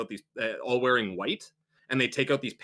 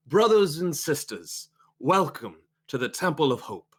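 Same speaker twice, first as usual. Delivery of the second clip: welcoming preacher; 4 semitones higher than usual. Acted. Preacher greeting the Temple of Hope's congregation, orating.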